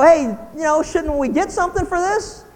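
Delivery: mock child begging a reward; whiny child. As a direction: ask like a kid angling for a prize. Voicing children wanting reward.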